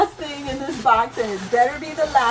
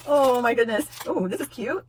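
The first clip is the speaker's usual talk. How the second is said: normal talking voice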